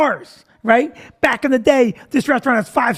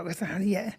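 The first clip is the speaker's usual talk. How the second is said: much softer, gentle reassurance; comforting; 3 semitones lower, 12 dB quieter than usual. Retold or imitated. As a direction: ease a crying child. Comforting a crying girl.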